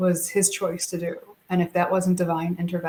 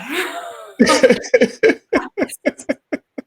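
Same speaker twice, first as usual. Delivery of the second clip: high, loud disbelieving laugh; real laughing fit; burst of laughter; 8 semitones higher, 8 dB louder than usual. Real, not acted. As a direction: laugh out the shock. Reacting to son driving expressway.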